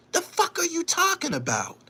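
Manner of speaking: normal talking voice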